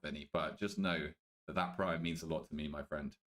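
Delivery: soft, low mock-sincere thanks; mock tender. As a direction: drop low and get fake-sincere.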